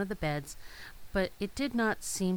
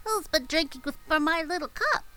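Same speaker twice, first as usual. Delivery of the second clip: high, light little dwarf asking; 10 semitones higher, 4 dB louder than usual. A narrator's reading. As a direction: pitch it up for the smallest dwarf. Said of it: "The seventh said".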